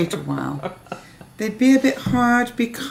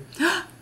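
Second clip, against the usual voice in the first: gasp; 10 semitones higher, 3 dB quieter than usual. Real, not acted. Spotting leftover chocolate.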